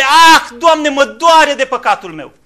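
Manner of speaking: loud, slow anguished lament; loud lamenting cry of repentance; wail of grief. Shown as a demonstration. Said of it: "Un strigă de jale".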